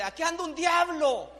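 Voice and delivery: high, loud mock scream of fright; retold frightened cry. Wife cries out in mock fright at her husband's touch. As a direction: shriek it like a startled wife. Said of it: "ella pega grito y dice".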